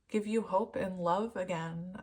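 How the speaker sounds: ordinary talk, nothing marked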